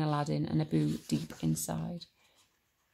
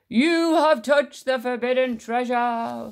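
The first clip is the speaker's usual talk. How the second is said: slow, loud, raised booming voice; wrathful, drawn-out proclamation; thundering cave voice; 6 semitones higher, 9 dB louder, slower than usual. A narrator's reading. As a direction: thunder it out word by word. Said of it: "thundered the voice of the cave".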